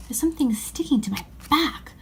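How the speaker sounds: hushed whisper. Acted. Boy whispering to his sisters in the dark wardrobe. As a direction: whisper the complaint.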